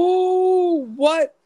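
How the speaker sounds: shocked. Real, not acted. Shocked by Tom Hanks claim.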